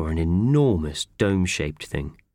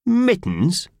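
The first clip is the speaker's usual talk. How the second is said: high, loud squawk of disbelief; 12 semitones higher, 4 dB louder than usual. A narrator's reading. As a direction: squawk the word like an outraged bird. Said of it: "he squawked".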